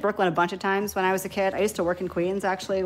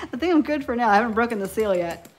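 ordinary talk, nothing marked

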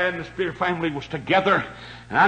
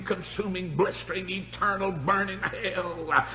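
softer, slow dread-filled list; hushed; 5 dB quieter than usual. Acted. Preacher warning the church of hell, hushed and slow. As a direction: let each word sink in quietly.

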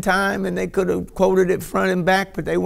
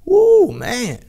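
overwhelmed cry; 5 semitones higher, 6 dB louder than usual. Real, not acted. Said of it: "Wow, heavy".

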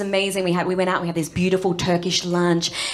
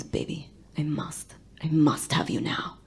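husky seductive whisper; hammed-up lover whisper; 2 semitones lower, 6 dB quieter than usual. Imitating seductive Stavros.

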